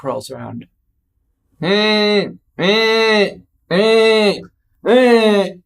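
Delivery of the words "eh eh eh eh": imitated vibrating phone buzz, steady and nasal; repeated buzzing noise. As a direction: mimic a phone buzzing on the nightstand.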